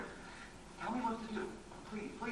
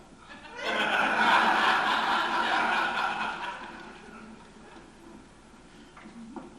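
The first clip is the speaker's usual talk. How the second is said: long rueful laugh; 3 semitones higher, 6 dB louder than usual. Acted. Laughs at himself.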